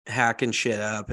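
usual speaking voice

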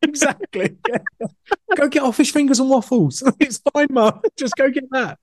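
put-on high childish voice through laughs. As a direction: giggle it like a cheeky kid. Continues kid voice laughing.

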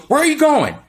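high, loud repeat of the question; yelling boss. Retold or imitated. Mover boss yelling at Chuck again, loud and high. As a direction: call him out again, sharper. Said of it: "He goes".

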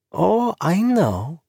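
knowing woman's voice. Acted. Zarena's knowing reply.

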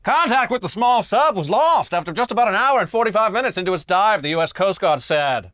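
put-on old-time newsreel mid-Atlantic accent. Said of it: "I should use a mid-Atlantic accent for this section".

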